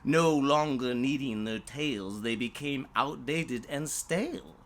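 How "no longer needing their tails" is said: ordinary delivery, nothing marked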